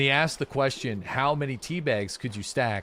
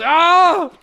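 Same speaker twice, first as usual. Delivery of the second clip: loud, high, slow squeal; shrieking high-pitched "gosh darn"; high squeaky exclaim; 11 dB louder, much slower than usual. Retold or imitated.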